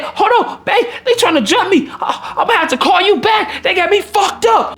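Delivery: high, fired-up voice cutting the call short; she's about to fight, hurry him off; heated girlfriend voice. Retold or imitated. Date on the phone with him while being jumped, high and heated.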